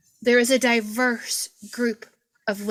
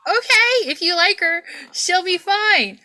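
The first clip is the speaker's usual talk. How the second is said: much higher and louder acted-out reply; bright agreeing reply; 9 semitones higher, 5 dB louder than usual. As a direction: play up a high-pitched, loud 'okay then'. Acting out reply to roommate offer.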